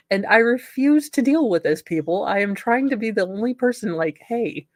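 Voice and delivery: normal talking voice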